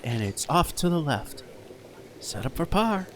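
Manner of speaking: hushed TV golf announcer voice; golf commentator. Acted. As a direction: call each shot softly like televised golf. Mock golf play-by-play.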